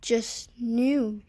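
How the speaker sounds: ordinary delivery, nothing marked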